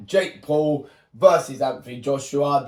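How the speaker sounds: ordinary talk, nothing marked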